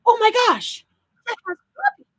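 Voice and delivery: high, loud burst of delight; excited exclamation. Retold or imitated. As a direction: re-enact a thrilled gasp of discovery. Retells spotting poppies to husband.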